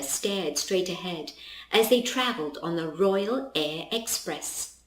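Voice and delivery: usual speaking voice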